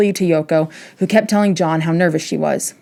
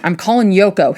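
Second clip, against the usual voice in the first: ordinary talk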